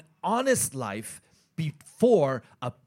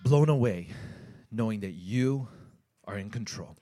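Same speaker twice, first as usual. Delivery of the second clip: prayer; 5 semitones higher, 6 dB quieter, faster than usual. Real, not acted. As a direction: pray quietly and humbly to God. Opening prayer before the message.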